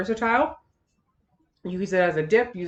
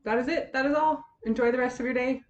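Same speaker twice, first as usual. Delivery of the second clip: bright, higher goodbye; cheery sign-off; 7 semitones higher than usual. Real, not acted. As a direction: wave them off happily.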